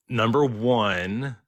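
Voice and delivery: measured, very slow retold answer; deliberate reply. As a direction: stretch both words as if choosing them carefully. Employee's halting, deliberate reply retold.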